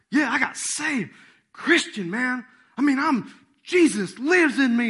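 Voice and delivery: high, loud, emphatic proclaiming; excited testimony. Acted. New convert answering his doubting buddies, loudly excited. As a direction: play an overeager convert proclaiming it.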